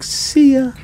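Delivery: high, singsong mock goodbye; breezy send-off. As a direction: imitate a diver cheerfully waving off a shark. Repeats the unbothered diver's reply.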